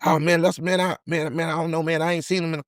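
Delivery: high, soft, fumbling denial; caught-out friend stammering softly; nervous denial. Retold or imitated. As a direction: mumble the excuse, a bit scared. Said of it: "Dude, why you nervous?".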